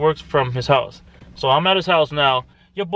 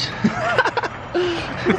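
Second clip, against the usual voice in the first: high laugh; 13 semitones higher than usual. Real, not acted. Laughs at George.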